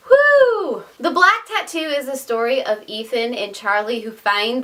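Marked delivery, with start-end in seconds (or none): 0.1-0.8 high, loud woo; excited whoop. Real, not acted. Vlogger whoops high and loud after running out of breath. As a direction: let out a bright whoop. Catching her breath, exclaiming.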